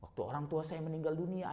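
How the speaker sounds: normal talking voice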